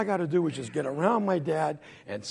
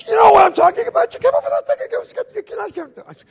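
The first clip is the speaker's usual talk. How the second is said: very high, frantic screeching tirade; hammed-up rant tumbling into garble; ranting mother; 13 dB louder, faster than usual. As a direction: scream the scolding, words running together. Mother scolds her son.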